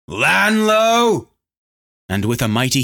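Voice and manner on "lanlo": long, high, loud battle yell. A narrator's reading. Lorn yells at his enemy from the air, loud yell. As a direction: call the name out high and loud. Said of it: "He yelled".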